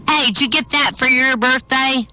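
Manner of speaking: put-on high-pitched playground kid; curious boy. Alex asks his friend about the watch, curious boy. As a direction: pipe it up like a little boy. A child voiced in a joke.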